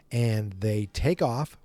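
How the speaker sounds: usual speaking voice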